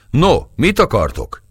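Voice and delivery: jovial bey asking the Italians what they want; cheerful. Acted. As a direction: ask it in good spirits. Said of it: "kérdezte jókedvűen".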